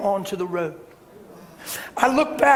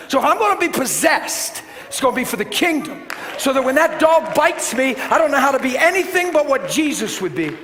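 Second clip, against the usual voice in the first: fervent preaching; 4 semitones higher than usual. Acted. Preacher to the congregation, fervent and rising. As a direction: drive the point home from the pulpit.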